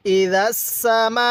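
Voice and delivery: slow "ইদা সামা" with the final "মা" held very long; four-alif stretch. Shown as a demonstration. Teacher demonstrating the long madd, "সামা" ending in a four-alif stretch. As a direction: sustain the last vowel of "সামা" on a level note. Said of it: "চার আলিফ টান দিব".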